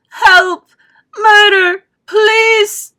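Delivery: high, loud hysterical cries; shouting. A narrator's reading. Mother shouts for police as son is sucked up. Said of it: "shouted Missus Gloop".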